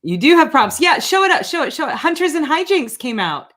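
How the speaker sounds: loud, high, real excitement; excited urging, pitched well up and loud; excited cheering. Real, not acted. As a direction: cheer your guest on to show it off. Host cheers guest showing the book.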